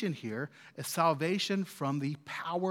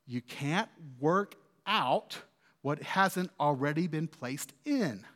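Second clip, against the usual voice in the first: ordinary talk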